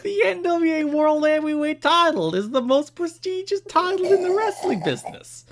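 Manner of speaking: high, loud hammed-up New Jersey accent. Shown as a demonstration. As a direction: read the letter as a goofy New Jersey fan. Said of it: "read this in a New Jersey accent".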